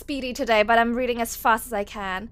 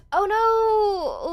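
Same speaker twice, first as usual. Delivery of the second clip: high, sudden gasp of alarm; real startled concern for a viewer; shocked oh no; 8 semitones higher, 4 dB louder than usual. Real, not acted. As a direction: gasp then cry out, much higher. Reacts to viewer's fall.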